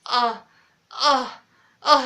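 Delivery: breathy mock moans, rising; imitated moaning. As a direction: ham up the moans coming through the door. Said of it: "the moans were just like".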